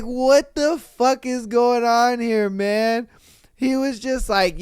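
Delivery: normal talking voice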